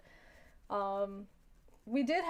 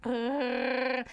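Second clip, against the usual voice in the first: dog growl. Retold or imitated. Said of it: "it stopped and went".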